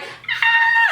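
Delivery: piercing scream, very high and loud; replayed scream of fright; retold scream. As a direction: shriek in sudden terror. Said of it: "And I'm screaming".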